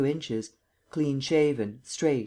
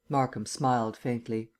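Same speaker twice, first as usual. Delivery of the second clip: plain reading voice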